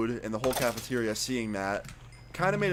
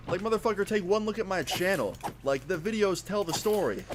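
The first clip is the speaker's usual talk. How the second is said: high-pitched, heated comeback in a retold argument; heated retort; 8 semitones higher than usual. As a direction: throw it back indignantly, pitched well up. Retold heated lunch table argument.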